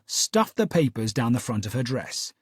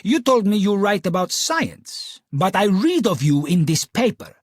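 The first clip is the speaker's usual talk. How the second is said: put-on Italian accent, rising in pitch; a lightly voiced Italian lady growing accusing; 5 semitones higher, 4 dB louder than usual. Client to Holmes, accusing in an Italian accent. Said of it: "seductive lilt of an Italian accent".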